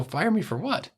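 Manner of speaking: high, rising disbelief; incredulous. Retold or imitated. Retelling the boss firing him, incredulous and high. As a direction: shoot the question up in shock. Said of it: "I go".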